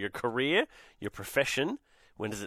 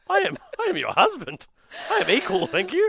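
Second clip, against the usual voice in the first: mock indignant; 11 semitones higher, 6 dB louder than usual. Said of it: "I'm like".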